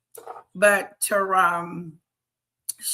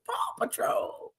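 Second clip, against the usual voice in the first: a secret whisper of the show's name; 8 dB quieter than usual. Pretend. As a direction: whisper it, breathy and soft. Repeating Paw Patrol playfully.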